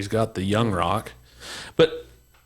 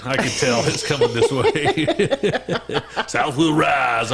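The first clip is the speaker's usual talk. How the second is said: slow, laughing rally cry; laughing mock proclamation; 5 semitones higher, much slower than usual. Joking that the South is rising, slow laughing proclamation. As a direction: drag it out like a rebel yell, laughing.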